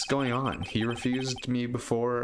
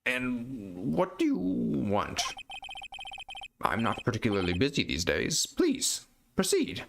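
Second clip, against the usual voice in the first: put-on pompous old lawyer. Old lawyer greeting the young visitor, pompous and slow. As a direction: savour every word like a grand old man. Grossberg voiced in game.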